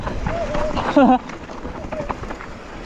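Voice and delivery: high, breathy giggle; high laugh. Real, not acted. Laughing during descent.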